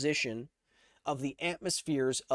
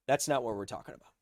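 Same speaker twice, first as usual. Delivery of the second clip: normal talking voice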